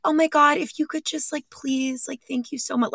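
imitating herself being extra sweet, high and hushed. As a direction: put on an over-polite, sugary voice. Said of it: "I know I had that voice".

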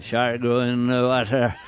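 slow gruff Quint voice; drawn-out salty sea captain; Robert Shaw impression. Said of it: "Robert Shaw in Jaws".

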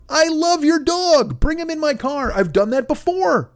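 high, effusive approval; gushing welcome. Acted. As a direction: gush at an honest dog owner, pitched high. Imagined delighted reply to honest owner.

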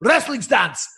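put-on Russian accent, shouted correction; yelling Russian coach. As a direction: bark the order like a furious Russian coach. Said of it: "heavy Russian accent".

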